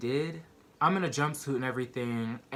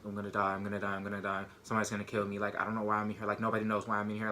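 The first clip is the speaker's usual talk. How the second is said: low, hushed, repetitive fretting; panicked muttering; 4 semitones lower, 4 dB quieter, much faster than usual. Retold or imitated. Rocking in a jail cell alone, muttering in panic. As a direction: mutter it to yourself in a retold panic. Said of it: "I'm just panicking".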